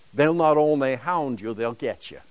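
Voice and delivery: normal talking voice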